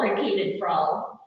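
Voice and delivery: ordinary delivery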